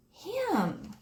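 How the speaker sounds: put-on high little-girl voice; lightly voiced young girl; child voice. A narrator's reading. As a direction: voice Cam high and eager. Cam pointing at the label.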